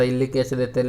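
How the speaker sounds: normal talking voice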